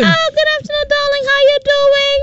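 put-on high, sweet girly voice; man doing a woman's voice. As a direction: put on a prim good-girl voice. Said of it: "Why are you sounding like you're a good girl?".

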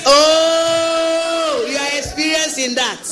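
long, high-pitched "oh" into a laugh; teasing amazement. Real, not acted. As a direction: tease with drawn-out, high mock awe. Teasing contestant about older women.

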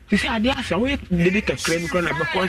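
high-pitched plea to mother in a retold scene; pleading with mother.

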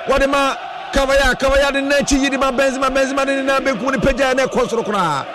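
rising, shouted play-by-play; excited commentary. Acted. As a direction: call the attack as it builds. Live play-by-play, build-up to a chance.

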